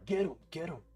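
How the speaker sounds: high-pitched urgent command; re-enacted yell to a guard dog. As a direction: call out high to rouse the dog. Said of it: "So I just yell".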